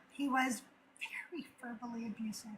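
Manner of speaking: drops to a whisper on the word abusive. Real, not acted. Recalling her abusive dad, she whispers. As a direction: say it under your breath, hushed. Lowers voice on painful topic.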